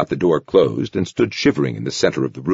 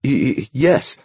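frightened stammer; 7 semitones higher, slower than usual. A narrator's reading. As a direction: stutter it out in fear.

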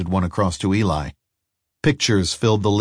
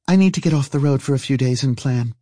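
higher character voice, earnest; woman traveller; 7 semitones higher than usual. Acted. Woman explaining her plans to an old friend, in a higher voice.

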